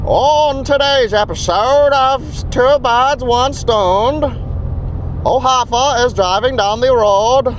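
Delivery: put-on high, slow old-time radio announcer; fifties announcer. Shown as a demonstration. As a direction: ham it up like a 1950s cartoon narrator. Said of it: "they'd have to talk like this".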